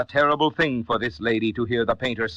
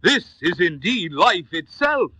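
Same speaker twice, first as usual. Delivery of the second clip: slow, higher, awestruck cry; loud cry; 6 semitones higher, slower than usual. A narrator's reading. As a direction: cry it out slowly, stunned by the painting. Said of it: "crying with a loud voice".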